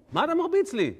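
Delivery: high, loud indignant cry; outraged protest. Acted. Donkey to Balaam over being beaten, outraged protest.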